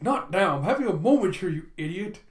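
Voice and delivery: irritated snap. Acted. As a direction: brush off the interruption sharply. Campbell snaps at his butler.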